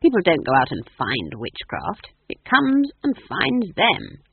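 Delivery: loud, firm correction; disapproving old witch laying down the law. A narrator's reading. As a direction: lecture her, slowing at the end. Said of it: "said Granny disapprovingly".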